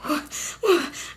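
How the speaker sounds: long shaky-feeling sigh out; heavy anxious sigh. Retold or imitated. Retelling her panic, a heavy sigh. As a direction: let out a nervous sigh before the panic. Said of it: "having a mini panic attack".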